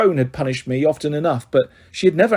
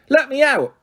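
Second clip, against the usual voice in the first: calling out; 10 semitones higher than usual. A narrator's reading. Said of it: "Kitty called".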